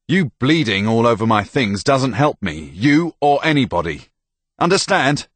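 loud, high, building exasperation; furious telling-off; angry scolding. Acted. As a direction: scold hard, rising to a sharp demand. Soldier keeps berating the girl.

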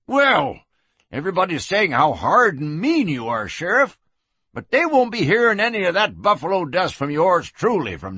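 put-on high-pitched, folksy old soak; elated town drunk. Freed drunk marveling at the sheriff, elated.

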